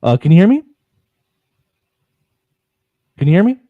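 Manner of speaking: loud mic check; calling out. Real, not acted. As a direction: raise voice to reach him. Checking a bad connection.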